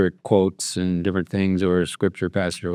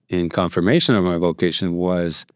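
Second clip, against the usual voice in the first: ordinary delivery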